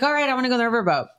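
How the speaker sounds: high, fast imitation of the bride; eager friend voice. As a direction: rattle it off in her chirpy voice. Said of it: "so she's like".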